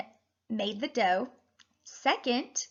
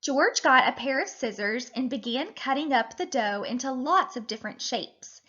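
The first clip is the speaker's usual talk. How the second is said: usual speaking voice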